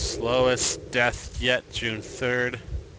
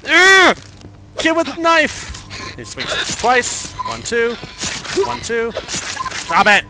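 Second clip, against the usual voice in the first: loud, high frightened yells; terrified shrieking under attack; panicked screams; 11 semitones higher, 8 dB louder, slower than usual. Real, not acted. Attacked by kid with knife.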